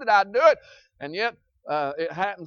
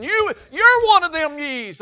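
loud, slow, higher pointed call; emphatic address; 7 semitones higher, 9 dB louder than usual. Real, not acted. Preacher telling each listener they are one of the ye's. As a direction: drive it home at the listener.

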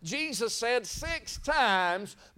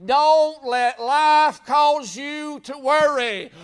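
slow, loud, higher preaching cry; fiery preaching; 4 semitones higher, 7 dB louder, much slower than usual. Acted. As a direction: thunder it out to the congregation. Preaching at full force.

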